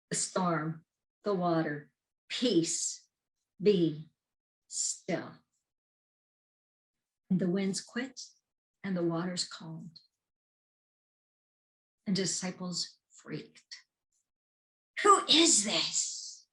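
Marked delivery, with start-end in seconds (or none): 2.3-5.3 firm words trailing to a hush; a touch of divine command; commanding calm. Acted. Jesus commands the storm. 15.0-16.5 high, loud, slow astonished ask; amazed disciples; awed question. Acted. Disciples amazed after the calm.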